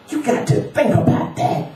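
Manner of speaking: high, strained, jabbing admonition; pointed old street-sage warning; insistent homeless man. Acted. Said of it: "He spoke each word as though jabbing".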